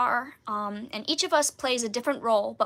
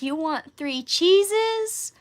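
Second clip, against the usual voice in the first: higher, slow, baffled question; puzzled worker; 6 semitones higher, 4 dB louder, much slower than usual. Retold or imitated. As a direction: stretch it out like he misheard, pitched up. Drive-thru worker mishears.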